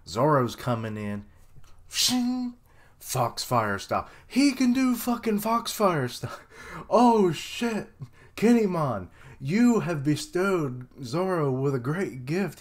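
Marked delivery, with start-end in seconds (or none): 1.9-2.5 high sharp imitated blade ring; sword shing. Fan reacting to Zoro's sword, sharp sword sound. 4.3-12.6 higher, louder thrilled outburst; can't contain the hype; excited fan. Real, not acted. Fan cheering Zoro's new Foxfire move, excited and loud.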